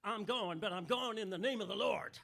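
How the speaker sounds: put-on loud, booming Southern American accent, like a blues singer; deep Southern accent. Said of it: "I'll try a deep Southern accent".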